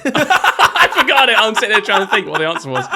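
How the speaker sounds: high, loud laughter through the words; laughing. Real, not acted. Host laughing at forgetting his own quiz answer, high and loud. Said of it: "I forgot the answer as well".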